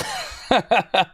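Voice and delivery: short, high-pitched laugh; real laugh. Real, not acted. Streamer amused by Harry's comment on Mariah, high laughing. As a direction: break into a quick laugh.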